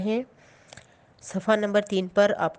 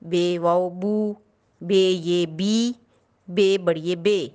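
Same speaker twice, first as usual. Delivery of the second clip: slow spelling drill; much slower than usual. Shown as a demonstration. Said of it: "مصوت الف کے ساتھ ملائیں گے".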